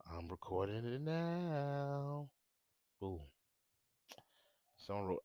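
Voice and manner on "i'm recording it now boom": slow, higher playful drawl; teasing. Real, not acted. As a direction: stretch it out teasingly. Teasing drawn-out reveal.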